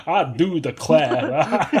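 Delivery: slow, loud Southern rooster drawl with a laugh; Foghorn Leghorn accent. Retold or imitated. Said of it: "doing the Foghorn Leghorn accent".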